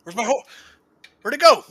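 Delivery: high, loud clown voice; silly clown. Pretend. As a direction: squeak it out like a panicking clown. Clown honk bit at sign-off.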